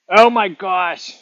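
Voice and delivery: slow hammed-up gasp of shock; mock surprise. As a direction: overplay the shock, slowly. Mock discovery on camera.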